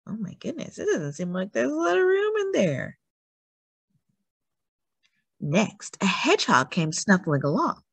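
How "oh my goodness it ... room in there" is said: high worried little creature voice; a small animal fretting about the crowded mitten; squeezed animal. Acted. Storyteller voices a cramped animal.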